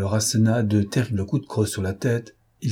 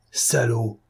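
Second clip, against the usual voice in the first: growled curse. A narrator's reading. The dealer reaching for his weapon, a growled curse. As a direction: curse him with a restrained growl. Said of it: "Gronda le brocanteur".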